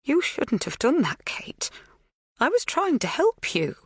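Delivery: high, breathy reproach; whispery plaintive scolding. A narrator's reading. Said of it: "Her voice is plaintive".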